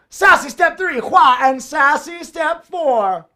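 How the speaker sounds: slow, high, loud sassy announcement. Pretend. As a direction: flaunt each step with hammed-up sass. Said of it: "The sassy way, just for all of you".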